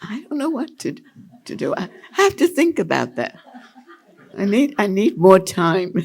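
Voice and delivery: high, giggling through the words; laughing. Real, not acted. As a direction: laugh it off, a little embarrassed. Laughs at own not making list.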